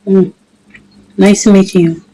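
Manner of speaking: ordinary talk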